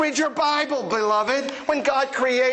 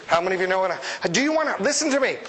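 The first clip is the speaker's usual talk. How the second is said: ordinary talk, nothing marked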